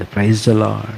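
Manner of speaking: soft, distant praise the Lord; almost under the breath, with reverence; quiet reverent greeting. Retold or imitated. Pastor recalls the man of God's instruction, quietly. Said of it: "దైవజనుడు ఇచ్చిన క్రమం".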